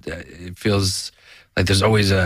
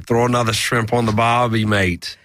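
Australian accent; 3 semitones higher than usual. Shown as a demonstration. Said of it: "How about your Australian?".

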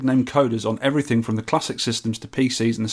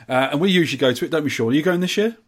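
ordinary delivery

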